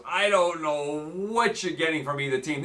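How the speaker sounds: ordinary talk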